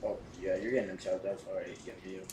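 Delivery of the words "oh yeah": usual speaking voice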